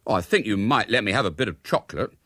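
put-upon schoolboy pleading; aggrieved wheedling. A narrator's reading. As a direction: build a self-pitying case like a child. Boy wheedles mother for chocolate.

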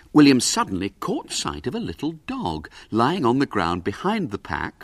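ordinary delivery, nothing marked

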